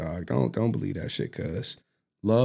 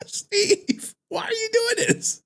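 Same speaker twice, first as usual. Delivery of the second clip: high whispery cry; mock anguish; slower than usual. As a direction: wail it like a betrayed friend. Mock anguished plea to Steve.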